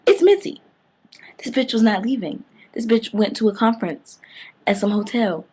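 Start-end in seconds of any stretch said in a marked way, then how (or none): 0.1-0.6 high, loud gasp of a reveal; shocked reveal. Acted. Vlogger revealing the twist to viewers, high shocked cry. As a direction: shriek the twist name.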